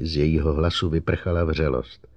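usual speaking voice

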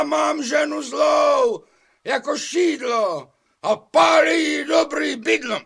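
slow, high shout against the storm; shouting over the sea. Acted. Fisherman shouts to the golden fish over storm. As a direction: bellow each line slowly over roaring waves. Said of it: "aby je překřik".

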